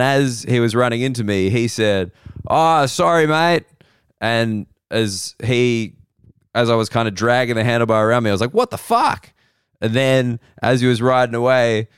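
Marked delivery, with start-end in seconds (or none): 2.5-3.6 quick, higher "oh sorry, mate" tossed off in passing; a casual throwaway sorry from a bike; mock cyclist apology. Cyclist apologising as he rides past, voiced a little higher. 8.5-9.3 a much higher startled yelp; surprised swearing. Retold or imitated. Startled comic reacting to the bike nearly hitting him, much higher. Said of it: "I swore when I was surprised".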